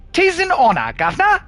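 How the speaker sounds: high-pitched, hammed-up Cockney; Cockney chimney sweep after a few pints; tipsy Cockney. Tall man greets the visitor, tipsy high Cockney. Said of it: "Cockney after a few pints? I can do that".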